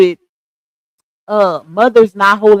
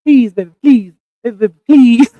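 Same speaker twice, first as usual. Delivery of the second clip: loud, punched-out begging; playful, hammed-up repeated plea; Spike Lee voice; 7 dB louder than usual. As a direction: plead in a mock Spike Lee voice. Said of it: "Lupac in her Spike Lee voice".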